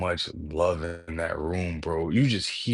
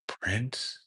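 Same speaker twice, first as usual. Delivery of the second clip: normal talking voice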